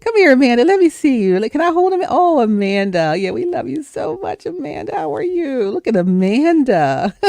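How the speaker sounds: put-on high cooing baby talk to a doll; doting baby talk. Aunts doting on the niece's doll, high cooing baby talk. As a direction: fuss over the doll like a newborn.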